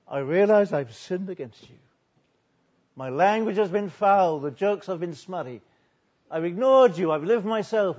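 loud confessing prayer, acted from the pulpit; forceful, preached admission of guilt; impassioned confession. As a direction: cry out a confession to God. Preacher acts out sinner's confession.

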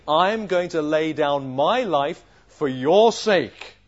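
very slow, loud, drawn-out vow; heroic, over-sure declaration; grandiose pledge. Acted. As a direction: proclaim it grandly, leaning on each word. Peter's pledge repeated incredulously.

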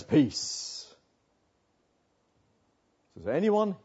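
usual speaking voice

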